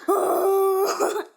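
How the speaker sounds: a single playful monkey ooh; monkey hoot. Pretend. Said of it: "I think she still sounds like this".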